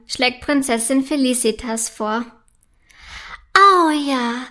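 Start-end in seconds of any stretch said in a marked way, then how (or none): 3.5-4.4 delighted, louder agreement; enthusiastic. A narrator's reading. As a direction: jump at the idea, stretching it out. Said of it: "ist von der Idee begeistert".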